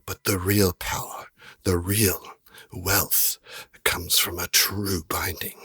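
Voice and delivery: lightly voiced merchant, slow and measured; a hint of a hushed, knowing dealer; genie trader. Game master as trader explaining binding to adventurers, slow and measured.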